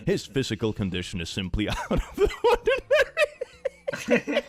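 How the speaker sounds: breaking into laughter mid-sentence; can't finish for laughing. Real, not acted. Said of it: "that could be implemented so many different ways".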